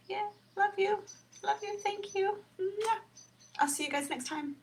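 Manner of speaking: between kisses. Real, not acted. Sign-off blowing kisses.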